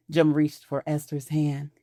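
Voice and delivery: usual speaking voice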